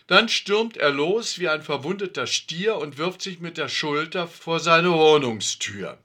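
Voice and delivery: usual speaking voice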